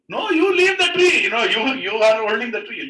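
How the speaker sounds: high, loud exasperated retort; acted heated argument at full voice; shouting back. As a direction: yell it back, drawing out the command. King shouts back at sage.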